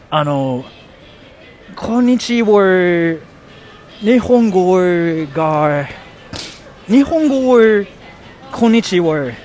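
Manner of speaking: slow, stretched-out beginner Japanese with an American accent; exaggerated beginner's Japanese; halting learner Japanese. Shown as a demonstration. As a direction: sound out the Japanese word by word like a shaky learner. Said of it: "my accent kinda sucks when I speak Japanese".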